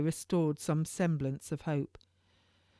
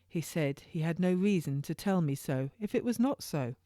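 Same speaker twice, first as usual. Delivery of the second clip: plain reading voice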